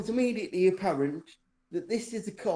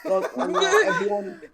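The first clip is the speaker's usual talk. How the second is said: laughing through the words; breaking into real laughter mid-sentence; 12 semitones higher, 6 dB louder than usual. Real, not acted. Telling friends the joke, laughing.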